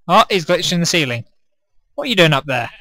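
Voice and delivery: ordinary talk, nothing marked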